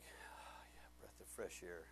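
breathy soft whisper of relief. Real, not acted. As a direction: exhale the words like cool air.

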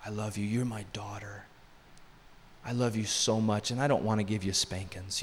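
very soft, low, near-whispered affection; tender reassurance. Retold or imitated. Father hugging his daughter after a spanking, tenderly reassuring. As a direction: comfort a child with a hushed, loving voice. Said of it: "I just begin to tell her".

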